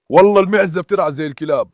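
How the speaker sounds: ordinary talk, nothing marked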